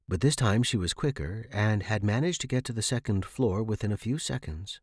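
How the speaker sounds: usual speaking voice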